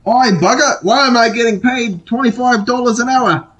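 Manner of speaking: put-on high Australian accent. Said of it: "I don't know why I did Australian with that".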